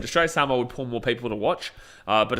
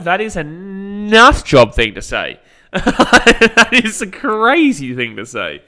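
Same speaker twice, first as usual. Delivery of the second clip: much louder and higher, slow and laughing in disbelief; incredulous outburst; 6 semitones higher, 12 dB louder, much slower than usual. Real, not acted. Streamer reacting to a viewer's Milford pick, laughing incredulous shout. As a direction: shout it out, laughing at how absurd the comment is.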